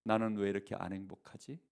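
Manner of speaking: a hint of crying in the voice; tearful. Hyori on her husband's lap, mildly tearful. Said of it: "눈물을 흘리며 얘기했어요".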